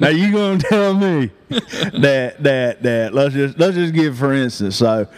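put-on country boy voice. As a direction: ham up a country boy, laughing through it. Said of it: "I'm gonna be like".

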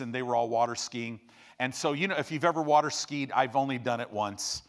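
ordinary delivery

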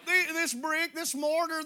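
ordinary talk, nothing marked

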